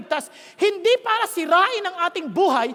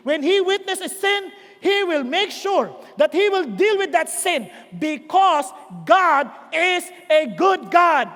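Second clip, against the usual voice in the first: slow declaration building to heavy, separated words; emphatic preaching; slower than usual. Real, not acted. Preacher insists to congregation that God is good, emphatic. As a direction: land every word slowly and firmly.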